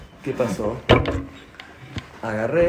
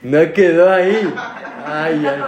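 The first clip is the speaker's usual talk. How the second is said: laughing; 4 semitones higher, 5 dB louder, slower than usual. Real, not acted. Teased that the secret got out.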